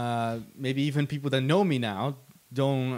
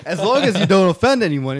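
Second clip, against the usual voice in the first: laughing; 7 semitones higher, 10 dB louder than usual. Real, not acted. Laughing mid-sentence.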